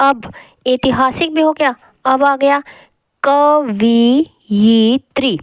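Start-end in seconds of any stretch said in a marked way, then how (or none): 3.2-5.4 कवियत्री drawn out syllable by syllable, slowly; a teacher slowly sounding out कवियत्री; slow spelling demo. Shown as a demonstration. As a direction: stretch out कवियत्री very slowly to show each sound. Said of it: "अब देखिए होता क्या है".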